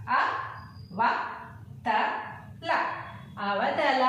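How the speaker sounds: first అ in అర, అలక, అవతల kept short; short vowels. Shown as a demonstration. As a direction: spell out అ, ర, then join as అర with a short, unstretched అ. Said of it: "షార్ట్గానే వదిలేసాం".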